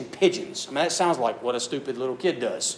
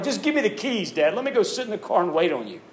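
put-on whiny kid; 6 semitones higher than usual. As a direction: beg like a bored kid who wants the car keys. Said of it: "something like".

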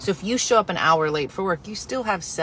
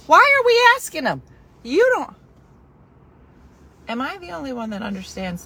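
exasperated; 8 semitones higher, 5 dB louder than usual. Real, not acted. Exasperated protest.

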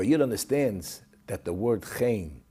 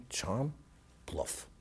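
ordinary delivery

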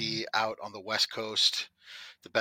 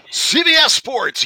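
high, loud booming announcer; hammed-up eighties sports announcer; sportscaster voice; 14 dB louder, slower than usual. Jokes about 1980s sportscaster headset.

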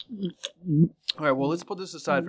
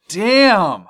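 excited; 7 semitones higher, 11 dB louder than usual. Real, not acted. Reacting to absurd beer name.

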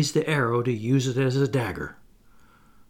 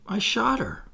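grieving; 8 semitones higher than usual. A narrator's reading. Toodles confesses in grief.

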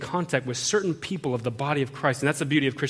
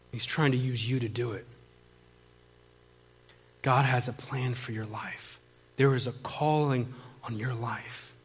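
hushed earnest reassurance, leaving space between phrases; grave, gentle plea; 2 semitones lower, 5 dB quieter than usual. Retold or imitated. Sister pleading with suicidal brother, grave gentle plea.

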